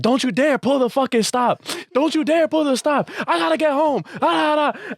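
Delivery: very high-pitched hammed-up protest; mock arguing yells. Whole train car arguing with lady, mock yelling. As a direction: imitate an outraged crowd yelling over each other. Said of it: "they're all just yelling".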